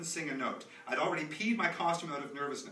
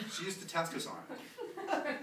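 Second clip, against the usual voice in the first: laughing; 10 semitones higher, slower than usual. Acted. Laughing at the memory.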